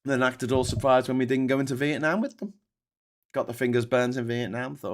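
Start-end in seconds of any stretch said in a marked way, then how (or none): none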